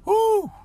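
high, loud whoo of joy; joyful whoop. Real, not acted. Host whooping for joy over his bishop, loud and high.

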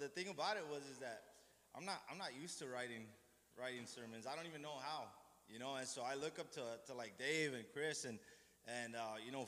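ordinary delivery

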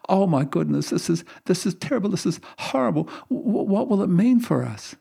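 high, distressed cry of shock; horrified. Acted. Wife hearing the doctor's news, horrified and high-pitched. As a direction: voice climbing in panic at bad news. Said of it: "The woman is absolutely horrified".

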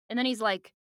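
ordinary talk

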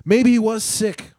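usual speaking voice

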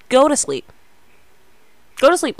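loud, high bossy order; bossy command. Real, not acted. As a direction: order them straight to bed. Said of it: "I'm just bossing you around".

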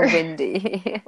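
"Wendy" drawn out as "Windy"; slow "Wendy" with a short i first vowel. Retold or imitated. Teasing a friend's vowels, "Wendy" as "Windy". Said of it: "she says Wendy instead of".

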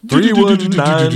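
high, slow, loud announcement; big slow radio-jingle voice; announcer voice. Acted. Announcing number.

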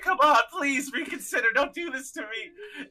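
high-pitched mock begging; pleading. Clive begging as they board escape pods, high mock pleading. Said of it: "Clive is just fucking pleading".

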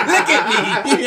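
high, loud taunt through laughter; laughing mock taunt. Mimicking the boxer to friends, high and laughing. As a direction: crow it while laughing.

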